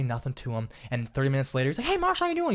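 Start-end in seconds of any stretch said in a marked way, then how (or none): 1.8-2.5 much higher, bright friendly greeting; cheerful coworker. Retold or imitated. Coworker greeting him later, all cheerful. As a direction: greet him all happy as if nothing happened. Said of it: "He's all happy".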